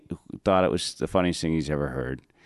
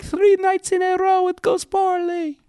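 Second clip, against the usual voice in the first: distraught cabbie; 3 dB louder than usual. Retold or imitated. Cab driver answering talk of fate, wailing impression. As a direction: wail it like a driver falling apart. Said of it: "And he's like".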